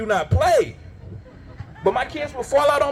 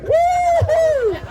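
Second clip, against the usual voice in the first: cheering wife; much slower than usual. Retold or imitated. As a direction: whoop like a fight fan ringside. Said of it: "looking like a boxer".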